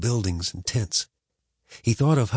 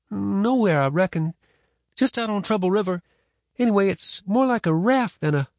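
put-on higher, shy boy explaining; boy's voice; 11 semitones higher than usual. A narrator's reading. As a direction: mumble on like a boy.

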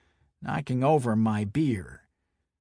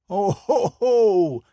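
mocking laugh; 9 semitones higher, 8 dB louder, slower than usual.

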